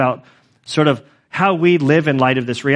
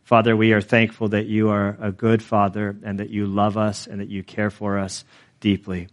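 hushed, unhurried closing prayer; 4 semitones lower, 4 dB quieter than usual. Acted. Pastor praying over the church, hushed and reverent. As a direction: pray it softly before God.

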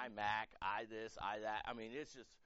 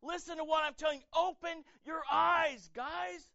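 much higher and louder, open your eyes very slow; emphatic plea; 12 semitones higher, 10 dB louder than usual. Shown as a demonstration. Pastor urging the congregation to see, loud slow plea. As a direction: preach it out, urgent and insistent. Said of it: "Verse thirty-five".

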